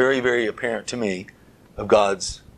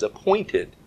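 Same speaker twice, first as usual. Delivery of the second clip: "appointed" jumps much higher with a falling finish; preacher driving home "appointed"; stressed appointed; 8 semitones higher than usual. Real, not acted. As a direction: land hard on "appointed". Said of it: "That's what that word means".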